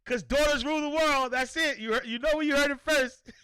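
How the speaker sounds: cheering his daughter's line; hyped announcement. Real, not acted. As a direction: crow it like breaking news. Triumphant hype after daughter's answer.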